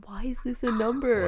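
hushed whisper, barely voiced. Real, not acted. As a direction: whisper so the call can't hear. Whispering while listening to call.

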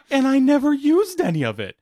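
whiny retold complaint; Mark Hamill. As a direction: imitate an aggrieved actor protesting. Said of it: "Quit your bitching".

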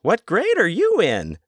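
put-on very high, slow sugary question to a kid; buffet guy to a child, hammed-up high; mock server voice. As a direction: coo it like a corny buffet server. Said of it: "the guy's like".

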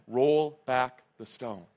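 unmoved by protest, space out each word; calm firm command. Retold or imitated. Jesus calmly repeats his order over protests.

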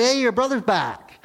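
bright, high call of good news; a servant's eager high shout of news; excited news. Acted. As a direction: blurt out the news, thrilled. Servant tells older son news.

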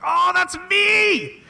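high, drawn-out mock squeal of delight; psyched. Host voicing a hero who hears people discussing him, psyched. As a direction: squeal it slowly, thrilled to be recognised. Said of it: "He's psyched".

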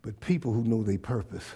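soft earnest call to stand; quiet earnest preaching. Real, not acted. Quiet sermon close.

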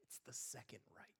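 wife quietly admits the mistake in a whisper. Retold or imitated. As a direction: whisper the correction under your breath. Retold whisper correction.

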